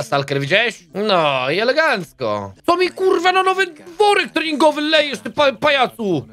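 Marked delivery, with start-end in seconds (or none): 2.7-6.3 much higher, outraged telling-off; raised-pitch indignant rant; mock angry scolding. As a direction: yell at the clown like a fed-up guard. Guard scolding a prisoner.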